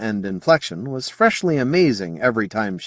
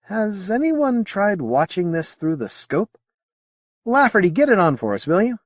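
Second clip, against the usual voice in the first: careful; 11 semitones higher than usual. Acted. Officer asking the crew carefully for the scope. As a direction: ask it carefully, weighing each word. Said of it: "Carefully, he said".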